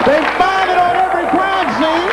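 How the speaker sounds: high-pitched comic exclamation; incredulous outburst. Real, not acted. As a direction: blurt it out in disbelief.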